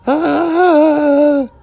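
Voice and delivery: high mock wail copying the soundtrack cry; mock war wail. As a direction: belt a short high wail like the theme music. Mimicking the theme's wail.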